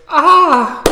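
loud, high "ah" of delight; big admiring exclamation; delighted gasp. A narrator's reading. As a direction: cry out at a lovely dress. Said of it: "As she goes".

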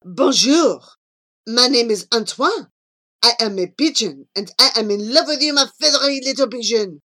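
brash second French pigeon suitor; Antoine. Acted. Antoine greeting Charlie with instant love, brash French suitor. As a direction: announce love loudly, quickening, in a French accent.

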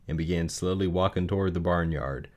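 ordinary delivery, nothing marked

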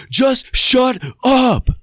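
sudden loud yell, pitched high; a furious shout in the theater. Retold or imitated. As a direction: bark it out like you've had enough.

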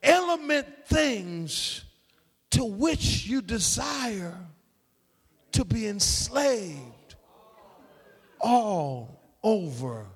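slow, word-by-word preaching with long pauses; emphatic, drawn-out recitation; emphatic scripture reading. Acted. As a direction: drive each phrase home, stretched and separated. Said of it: "It's an emphatic in the Greek".

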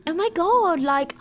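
put-on high bubbly Valley Girl squeal; Valley Girl accent. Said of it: "oh, she's trying".

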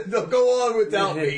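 loud, high melodramatic sacrifice; overacted death scene. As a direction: ham up a heroic farewell. Mock death scene joke.

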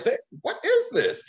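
high, slow puzzled parent voice; drawn-out high bewildered question; imitating parents. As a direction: play baffled parents. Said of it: "parents are like".

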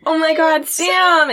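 high-pitched mock relief; overwhelmed Ava. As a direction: exclaim it high, greeting a rescuer. Acting Ava's hysterical relief at rescue.

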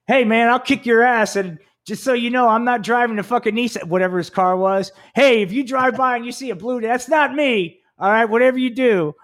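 high, loud hammed-up threat; mock tough guy. Teasing his road trip buddy over the CB, mock tough threat. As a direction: play the goofy bully over the radio. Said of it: "I was just like".

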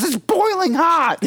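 high, drawn-out mock scream of pain; scalded yelp. Intern gets coffee spilled on his face, hammed-up high scream.

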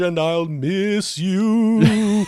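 drawn-out spooky ghost moan. Pretend. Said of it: "speak in ghost voice".

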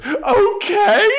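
okay squeaked very high and loud, half crying; a wounded squeal of okay before storming out; shrill hurt okay. Retold or imitated. Anya answers Xander with a high, near-tearful okay.

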